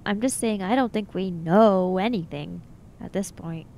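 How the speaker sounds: ordinary talk, nothing marked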